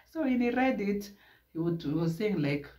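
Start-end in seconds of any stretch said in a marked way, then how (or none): none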